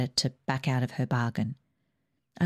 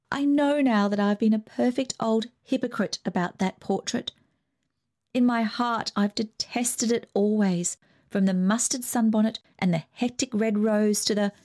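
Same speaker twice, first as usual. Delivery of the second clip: higher, outpouring confession; bursting out; 6 semitones higher than usual. Acted. Ruth confessing to her family, bursting out higher. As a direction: let it all spill out at once. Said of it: "she burst out".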